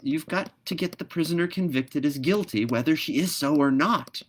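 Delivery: a brisk little bird laying down the rule; lively swallow voice. Acted. Swallow instructs the pelican.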